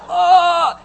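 high, loud comic cry of pain; hammed-up scream of agony; agonized yell. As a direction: yell through a cramp. Acting out chili dog agony.

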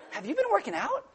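high, soft, teasing purr; flirty admiring. Pretend. Wife flattering husband's muscles, high soft flirt. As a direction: flatter him softly.

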